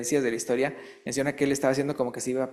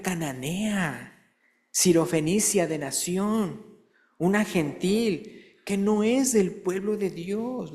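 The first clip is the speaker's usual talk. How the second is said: slow, raised preaching voice; preaching emphasis; 5 semitones higher, slower than usual. Acted. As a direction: stretch it out like a preacher. Preaching build, slow and high. (predicación enfática y pausada)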